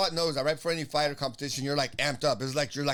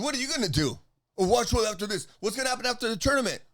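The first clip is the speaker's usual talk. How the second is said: higher, hurried re-enacted challenge; confrontational comeback; 6 semitones higher than usual. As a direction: throw the challenge back. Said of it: "I said".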